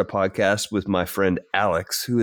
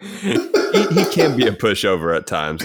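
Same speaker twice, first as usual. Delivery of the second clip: laughing; 3 semitones higher, 4 dB louder than usual. Real, not acted. Teasing joke about his co-host.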